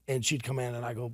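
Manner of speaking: usual speaking voice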